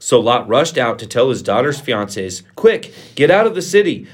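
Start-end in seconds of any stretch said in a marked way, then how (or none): 2.5-4.1 high, raised-voice alarm; urgent call to get moving; urgent warning. A narrator's reading. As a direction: warn them to flee at once. Lot urgently warns the fiancés.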